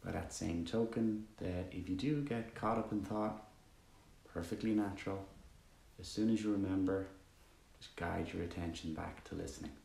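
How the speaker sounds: calm, even meditation voice with long pauses; guided meditation. Acted. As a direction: guide listeners gently into stillness, leaving long silences.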